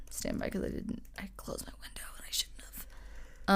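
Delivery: voiceless whisper while looking something up; whispered aside. Real, not acted. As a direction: mutter it under your breath to yourself. Aside while searching her computer.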